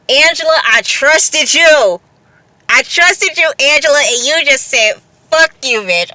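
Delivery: like a jilted friend calling her out; mock betrayed. Fan calling out Angela for betraying her trust, mock hurt.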